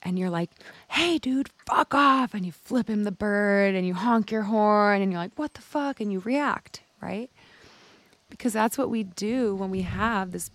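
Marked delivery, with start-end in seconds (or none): 0.9-2.3 high, loud angry yell at a driver; road rage yell. Retold or imitated. Driver yelling at the man who cut him off, angry high shout. 5.4-6.0 high-pitched indignant what-the; mock outraged yell. Driver reacting to being cut off, high outraged cry. Road rage.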